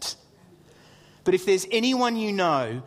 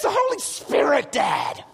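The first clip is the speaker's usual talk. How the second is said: high shouted answer, dropping on Dad; exclaiming child; 10 semitones higher than usual. Retold or imitated. Son explaining it to dad, high excited shout.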